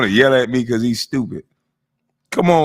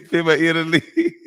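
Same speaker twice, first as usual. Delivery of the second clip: high mimicry breaking into laughter; laughing; 5 semitones higher than usual. Real, not acted. Host mocking the man's word, laughing. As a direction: crack up while repeating the word.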